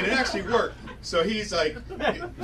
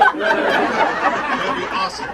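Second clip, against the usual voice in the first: laughing, slow and louder; laughing excited; 7 dB louder, much slower than usual. Real, not acted. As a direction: laugh it out, drawn long. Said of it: "repeated line".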